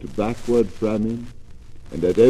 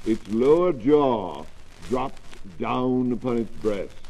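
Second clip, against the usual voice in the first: plain reading voice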